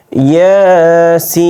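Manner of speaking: Yasin chanted with its last vowel held very long; Quranic recitation. Shown as a demonstration. Teacher demonstrates the long pull on সিন, chanted recitation. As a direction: sing out Yasin slowly, stretching the সিন. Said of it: "তারপরে দেখেন".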